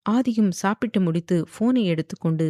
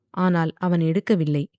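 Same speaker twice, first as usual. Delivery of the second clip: ordinary delivery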